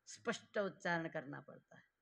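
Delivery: normal talking voice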